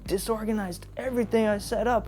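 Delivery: high, indignant imitation; mock an aggrieved owner without raising volume; retold angry complaint. Owner accusing customer of wrecking his shelves, retold indignantly.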